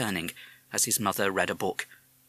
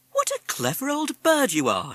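high-pitched praise for a pet; admiring mother; 5 dB louder than usual. A narrator's reading. Mother praising the parrot, high and admiring. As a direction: coo over the bird in a high voice.

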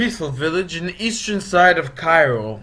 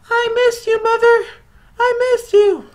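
put-on very high, loud squeaky baby voices; tiny baby snakes; 6 dB louder than usual. Baby snakes back with their mother, very high squeaky glad voices. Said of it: "decide to wiggle back ... and say".

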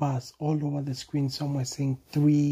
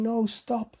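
panicky monkey; 7 semitones higher than usual. A narrator's reading. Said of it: "Bonobo was saying".

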